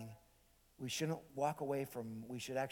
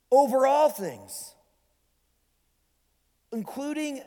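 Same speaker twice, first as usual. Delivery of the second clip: slow, high, loud declaration; awed proclamation; 8 semitones higher, 8 dB louder than usual. Acted. Peter declares Jesus top authority, awed proclamation. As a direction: proclaim it with weighty pauses.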